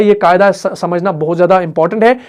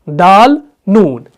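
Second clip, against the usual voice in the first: very slow, spaced letter names; slow letter naming; 2 semitones lower, much slower than usual. Shown as a demonstration. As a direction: drag out each letter name. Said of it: "आइन".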